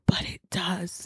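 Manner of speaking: hushed whisper; an intimate whisper leaning in. Retold or imitated. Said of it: "unvoiced whisper".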